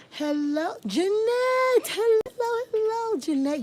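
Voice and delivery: high, loud, delighted hello; excited greeting. Real, not acted. Greeting a viewer who just joined, high and loud. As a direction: call out to a friend arriving.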